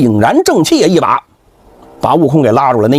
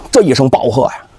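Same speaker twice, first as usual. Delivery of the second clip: ordinary delivery, nothing marked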